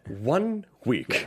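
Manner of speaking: drawn-out, portentous imitation of a villain; spelled-out villain line. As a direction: stretch each word like a movie villain. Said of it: "I think he even goes".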